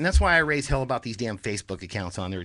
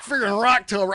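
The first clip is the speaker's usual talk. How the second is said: radio announcer; 7 semitones higher than usual. Acted. Host opening his call-in show, hyped announcer read. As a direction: pump up the listeners like a DJ.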